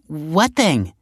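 high, eager question; excited. A narrator's reading. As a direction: pounce on the news excitedly. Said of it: "said Taylor excitedly".

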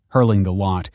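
usual speaking voice

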